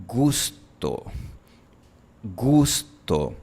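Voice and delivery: gusto with a pure back Spanish u. Shown as a demonstration. Teacher modelling Spanish gusto, tongue barely moving. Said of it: "Cuando decimos".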